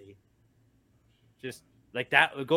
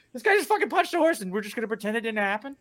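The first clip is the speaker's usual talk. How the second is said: outraged Twitter voice; 9 semitones higher, faster than usual. Retold or imitated. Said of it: "there were people like".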